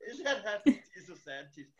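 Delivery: high, loud mock child through laughter; laughing mimicry. Host repeating the daughter's question back, laughing. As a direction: echo the child's question while cracking up.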